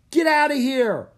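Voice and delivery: high, very loud jeer; heckler shouting. A narrator's reading. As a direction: shout them off the field. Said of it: "another heckler shouted".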